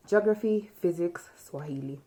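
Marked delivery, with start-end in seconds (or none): none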